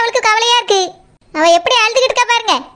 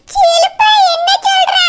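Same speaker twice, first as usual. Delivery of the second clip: shocked outcry; 8 semitones higher, slower than usual. Acted. Aunt shouting at Shilpa in shock over the flood. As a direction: cry out her name in shock. Relative hears the house flooded.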